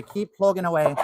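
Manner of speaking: a playful put-on Irish accent. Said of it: "We can say it in an Irish accent today".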